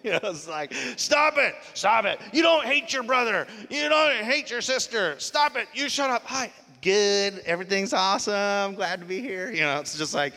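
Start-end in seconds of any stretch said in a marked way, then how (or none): 1.0-6.2 sharp, high, loud parental scolding; scolding the kids. Retold or imitated. Parent yelling at squabbling kids in the car on the way to church, high sharp scolding. 6.2-9.5 bright put-on churchy smile in the voice; fake cheerful greeting. Churchgoer greeting people after a fight in the car, mock cheerful.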